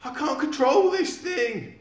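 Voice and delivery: loud, high panicked holler. Acted. Old man hollering from the runaway truck. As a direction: bellow it over the wind, slowly. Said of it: "hollered the old man".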